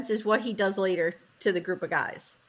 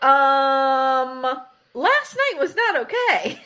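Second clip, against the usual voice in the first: mock incredulous reply; 2 semitones higher, 5 dB louder, much slower than usual. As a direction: imitate an affronted wife. Wife answering the creepy husband.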